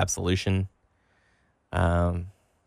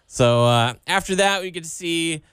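normal talking voice

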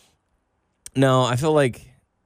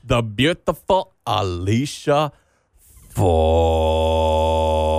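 slow, drawn-out announcer intro of Alicia Fox; bill her like a ring announcer, stretching each word; hammed-up ring announcer; 4 semitones lower, much slower than usual.

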